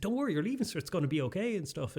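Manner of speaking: a flustered man rushing to calm a student; panicking reassurance. Retold or imitated. The vice principal panicking to reassure the student. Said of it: "again, panicking".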